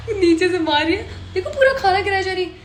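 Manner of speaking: talking through giggles; laughing. Real, not acted. Laughing while describing the sisters' prank at the table. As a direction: laugh out the words.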